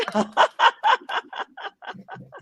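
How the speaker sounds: high, wheezy peals of laughter; long high laughing fit; big laugh. Real, not acted. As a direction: crack up completely. Amused at hearing the name said.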